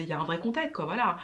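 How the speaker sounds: usual speaking voice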